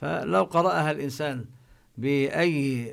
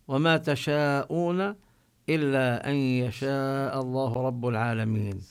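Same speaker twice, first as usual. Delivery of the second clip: Quran recitation. Acted.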